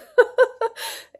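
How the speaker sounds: high, loud burst of laughter; amused laugh at her own slogan; real laugh. Real, not acted. Laughs at stamp out campaign.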